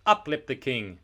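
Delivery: plain reading voice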